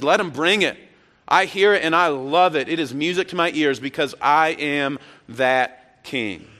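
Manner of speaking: high, loud and exultant; triumphant Jesus. Retold or imitated. Jesus defying the priests, loud triumphant joy. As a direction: welcome the praise with joy. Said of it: "So Jesus says".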